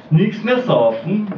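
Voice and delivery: ordinary talk, nothing marked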